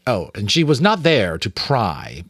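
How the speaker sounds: ordinary delivery, nothing marked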